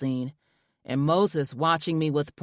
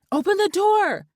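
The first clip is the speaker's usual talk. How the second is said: high, loud shout; excited shout; 6 dB louder than usual. A narrator's reading. Wife shouting at husband to open the door, high and loud. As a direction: shout it at him eagerly. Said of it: "I shouted".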